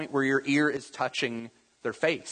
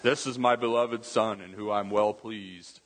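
low-pitched movie voice of God; mock-solemn heavenly pronouncement; booming God voice; 4 semitones lower than usual. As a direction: proclaim it like God in a film, without raising the volume. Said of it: "that booming, loud voice".

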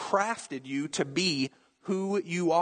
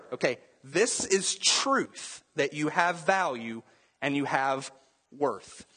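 ordinary talk, nothing marked

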